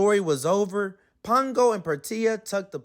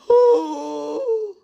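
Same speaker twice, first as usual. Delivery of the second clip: high, sharp startled gasp; a puppy's startled yelp; surprised yelp; 12 semitones higher, 4 dB louder than usual. Acted. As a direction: gasp in fright. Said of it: "yelped in surprise".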